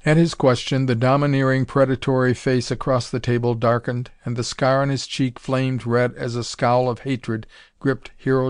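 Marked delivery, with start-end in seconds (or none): none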